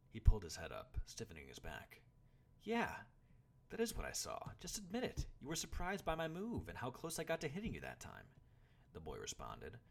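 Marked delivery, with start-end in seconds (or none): 2.6-8.3 high, insistent annoyance; boy protesting in a raised pitch; annoyed. A narrator's reading. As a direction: press him to admit it, irritated. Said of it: "annoyed that Craven couldn't just admit".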